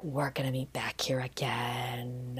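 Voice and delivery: slow, low conspiratorial whisper; mock-furtive lovers' hush, drawn out; secret whisper. Lovers agree to meet back here, secretive whisper. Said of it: "But they're like".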